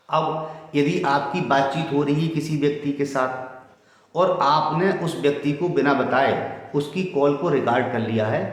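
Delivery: ordinary delivery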